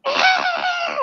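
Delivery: high yowling cat cry; imitating the cat. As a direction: mimic the cat yowling in pain. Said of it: "And he's just like".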